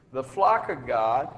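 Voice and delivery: normal talking voice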